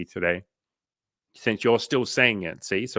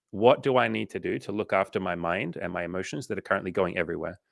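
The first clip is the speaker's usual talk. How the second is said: ordinary talk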